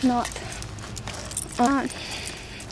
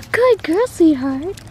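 high, sing-song praise for the dog; 5 semitones higher, slower than usual. Real, not acted.

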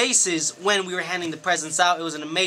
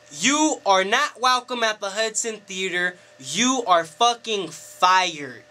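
slow, word-by-word text readout; stern pronouncement; slower than usual. Retold or imitated. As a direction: read the firing message out with slow, weighty emphasis. Reads out owner's firing text.

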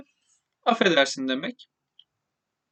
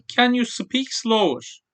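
slow model phrase; 4 semitones higher, much slower than usual. Shown as a demonstration. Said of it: "teacher models English phrase".

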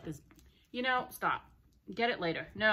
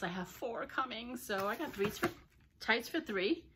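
normal talking voice

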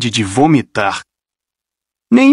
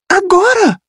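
urgente. Acted. Rachel apavorada.

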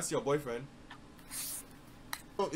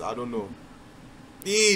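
ordinary delivery

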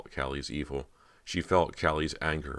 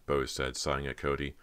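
ordinary delivery

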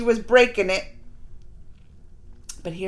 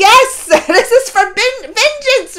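high, loud mock fury; hammed-up vengeful yell; vengeful outburst; 9 semitones higher, 9 dB louder than usual. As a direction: imitate someone crying out for revenge. Acting out vengeful answer to stepmom.

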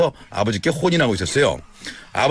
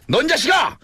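scolding father; 11 semitones higher than usual. Retold or imitated. Father scolding his son.

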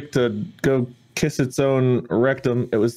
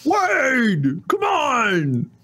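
high, drawn-out mock complaint; whining fake fan; much slower than usual. Imitating a viewer who has no audio, high whine. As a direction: wail like an exasperated clueless viewer. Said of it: "idiotic can't hear Mark accent".

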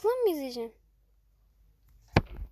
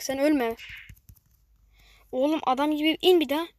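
ordinary talk, nothing marked

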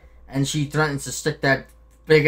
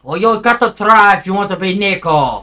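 put-on loud, raised Eastern European accent; Nico Bellic impression; 5 semitones higher, 10 dB louder than usual. Player showing his friend how it's done, loud Eastern European accent. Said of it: "scary as fuck that you can do that".

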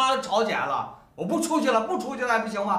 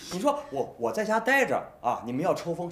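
normal talking voice

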